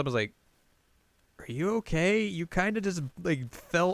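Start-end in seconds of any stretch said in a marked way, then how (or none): 1.4-3.9 high, puzzled concern; concerned boy, imitated. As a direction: ask carefully with the pitch lifted. Boy checks on her after the fall.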